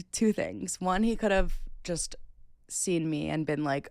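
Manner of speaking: ordinary delivery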